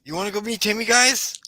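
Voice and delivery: put-on high-pitched eager kid invitation; Tony, hopeful. Game master as Tony inviting the group to meet Timmy, hopeful.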